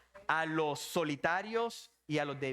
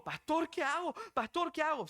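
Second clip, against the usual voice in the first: high-pitched frightened plea for help; imitated panic; 10 semitones higher than usual. Scared woman phones pastor for help, imitated panic. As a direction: beg the pastor, acted-out fright. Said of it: "le dio tanto susto que me llamó".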